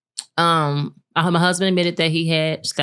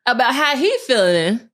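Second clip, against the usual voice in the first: laughing disbelief; 11 semitones higher, 3 dB louder than usual. Acted. Woman laughing at herself for caring about him, much higher. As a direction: scold yourself, half laughing, half outraged. Exasperated at her own past self.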